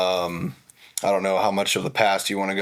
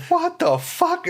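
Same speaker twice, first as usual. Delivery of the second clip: very high, slow incredulous cry; mock disbelief; slower than usual. Reacting to rude welcome, mock disbelief. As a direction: stretch out the shock.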